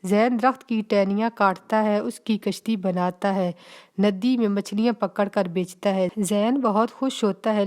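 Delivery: ordinary delivery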